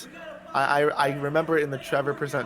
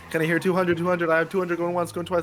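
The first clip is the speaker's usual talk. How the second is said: fast rolling auction call, pitched up; auctioneer; 6 semitones higher, 3 dB louder than usual. Retold or imitated. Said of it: "An auctioneer is the guy who's like".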